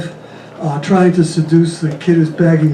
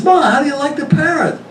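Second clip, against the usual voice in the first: son on the phone; 9 semitones higher than usual. Acted. Son calls mother, jokey voice.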